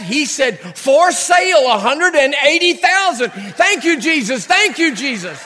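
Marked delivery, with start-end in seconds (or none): 0.8-3.3 pitched-up, louder triumphant reading; like cracking a code; mock eureka; excited reveal.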